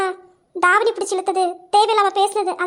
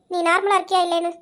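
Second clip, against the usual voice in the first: ordinary talk, nothing marked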